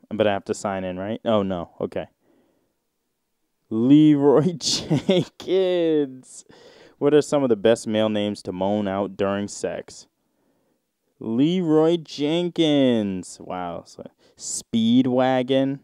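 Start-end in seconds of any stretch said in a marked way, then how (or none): 3.7-6.4 drawn-out, hammed-up moan of the name; a slow, exaggerated mock moan through a laugh. Moaning names read from sex thread. 11.2-13.4 slow, hammed-up moaned name; mock moan. Moaning names read from sex thread.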